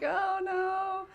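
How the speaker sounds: high, half-sung panicky fretting; mock despair in a lilting voice; playful high sing-song alarm; sing-song worry. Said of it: "I'm like".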